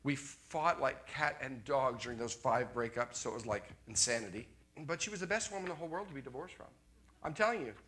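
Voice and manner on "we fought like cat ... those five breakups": ordinary talk, nothing marked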